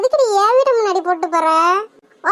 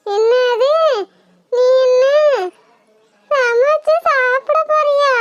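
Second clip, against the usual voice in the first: higher, slow, crying in terror; horrified wail; 3 semitones higher, much slower than usual. Acted. Woman told the ghost will cook her, wailing in terror. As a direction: wail in disbelief before fainting. Victim hears she'll be eaten.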